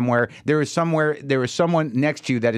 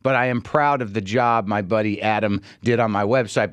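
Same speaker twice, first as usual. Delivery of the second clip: usual speaking voice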